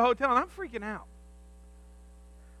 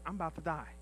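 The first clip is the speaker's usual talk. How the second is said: much softer inner voice; quiet dread; 5 semitones lower, 15 dB quieter, faster than usual. Retold or imitated. Pastor telling himself he's about to die, much softer. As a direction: say it to yourself in a hushed fear. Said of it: "think to myself".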